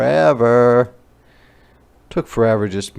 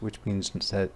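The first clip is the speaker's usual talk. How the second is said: ordinary delivery, nothing marked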